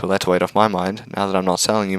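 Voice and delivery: usual speaking voice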